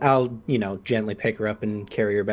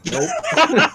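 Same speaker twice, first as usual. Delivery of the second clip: laughing no; 6 dB louder than usual. Real, not acted. Laughing refusal.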